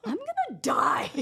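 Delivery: high, loud, slow mock despair; hammed-up cry of overwhelm; stressed outburst. Overwhelmed junior doctor after all night, mock despair. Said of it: "I cannot handle this stress".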